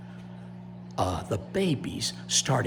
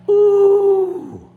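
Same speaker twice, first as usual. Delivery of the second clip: loud, very high "ooh"; relieved gator ooh; 10 dB louder than usual. Shown as a demonstration. Storyteller having listeners echo the gators, loud high ooh. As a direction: lead a long high ooh for repeating. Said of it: "Repeat after me".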